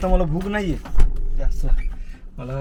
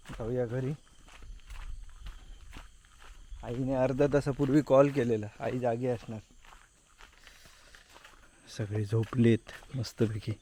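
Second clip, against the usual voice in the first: hushed, low voice so nobody wakes; quiet at night; 6 semitones lower, 8 dB quieter than usual. Real, not acted. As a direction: keep it soft, family's asleep. Home late at night, everyone asleep.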